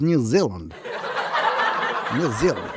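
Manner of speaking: hammed-up New Zealand accent; joking demo of New Zealand speech. Shown as a demonstration. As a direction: mimic a New Zealander saying the name. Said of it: "New Zealand talks like this".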